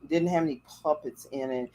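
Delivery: ordinary delivery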